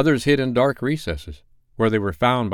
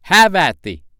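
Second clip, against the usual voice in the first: loud, high battle cry; ringing duelist's challenge; Peter; 9 semitones higher, 8 dB louder than usual. A narrator's reading. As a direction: launch the attack with a shout. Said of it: "Peter answered".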